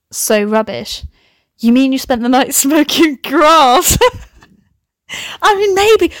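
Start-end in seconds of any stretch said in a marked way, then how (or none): 1.6-6.1 high, laughing aside; laughing tease. Real, not acted. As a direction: giggle through the joke. Mock accusing then laughing.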